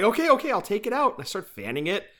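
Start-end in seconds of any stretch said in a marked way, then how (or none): 0.0-1.1 flustered giving in. Retold or imitated. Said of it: "I'm like".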